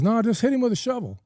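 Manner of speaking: high-pitched acted egging-on; animated mock insistence; imitated excited urging. Acted urging to hit badger.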